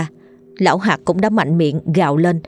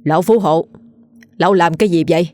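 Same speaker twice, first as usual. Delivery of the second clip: a mild stern bark at the rich man; young magistrate scolding the rich man, lightly voiced; a hint of an official's angry demand; magistrate's rebuke; faster than usual. A narrator's reading. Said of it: "quan đã quát".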